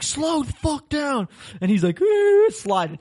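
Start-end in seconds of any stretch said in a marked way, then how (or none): 0.0-1.3 high, drawn-out mock yell; retold yell. Retold yell at the reckless driver. 2.0-2.5 high-pitched, louder "ooh"; imitated squeal. Imitating driver's reckless slide.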